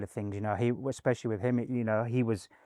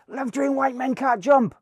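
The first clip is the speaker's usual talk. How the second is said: very high, loud holler; mock fan call, pitched way up; imitated fan shout; 14 semitones higher, 7 dB louder than usual. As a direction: yell it like an excited fan. Imitates fans calling to Harrelson.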